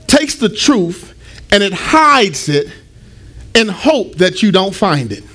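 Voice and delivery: slowed right down, words spaced out; slow and deliberate. Shown as a demonstration. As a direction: lay it out slowly so it sinks in. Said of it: "That's the purpose of a riddle".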